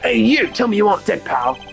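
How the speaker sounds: high, loud acted shout; alarmed calling out. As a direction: call out urgently to rouse someone. Calls to man feared dead.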